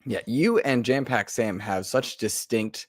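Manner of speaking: ordinary talk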